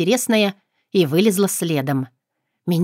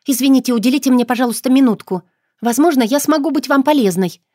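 fast, higher pushy patter; rapid patter; 5 semitones higher, 4 dB louder than usual. Acted. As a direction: blurt it out like a pushy promoter. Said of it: "скороговоркой выпалила я".

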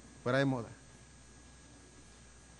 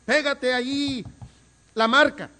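higher, louder cheering call, slowed; mock fan shout; 9 semitones higher, 7 dB louder than usual. Fan at a neighbourhood match yelling to his defender, mock shout. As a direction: cheer on a teammate from the sideline. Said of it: "y le decía".

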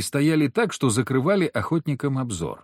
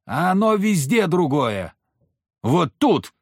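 higher, emphatic female retort; женщина-охотница, горячая; 6 semitones higher, slower than usual. A narrator's reading. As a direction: argue back hotly in a woman's lifted voice. Female hunter argues passionately back. (горячий женский голос, повыше)

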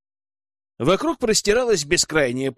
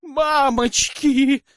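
much higher, louder, stretched-out wail; panicked wail; 11 semitones higher, 4 dB louder, much slower than usual. A narrator's reading. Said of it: "завопила водитель".

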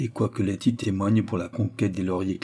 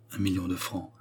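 hushed, much softer, with new respect; suddenly respectful; 2 semitones lower, 7 dB quieter than usual. A narrator's reading. Suzy awed by the inheritance, softly respectful. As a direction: let the sum awe you into softness. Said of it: "subitement respectueuse".

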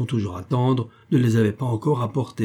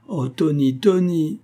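higher, mildly distressed lament; tearful exclamation; 6 semitones higher, slower than usual. A narrator's reading. Mother crying in her turn over Tony, tearful lament. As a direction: lament the name, understated weeping. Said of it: "s'écria-t-elle en pleurant à son tour".

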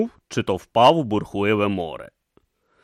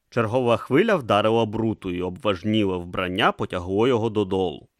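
usual speaking voice

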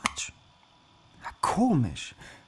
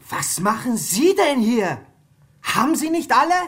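outraged; 11 semitones higher, 7 dB louder than usual. Acted. Flatmate finding a stranger who broke in, outraged shout. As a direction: confront the intruder furiously.

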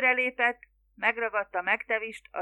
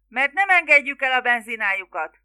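loud, raised-pitch crowd threat; shouted threat; 4 semitones higher, 11 dB louder than usual. A narrator's reading. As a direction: yell it out at them. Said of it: "ordították". (hangosan odakiáltott fenyegetés)

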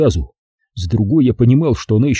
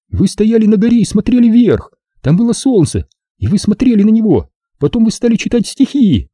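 put-on high-pitched girl character, insistent; defiant girl; 12 semitones higher than usual. A narrator's reading. Zaza tells how she followed them, defiant. Voices Zaza proving her story.

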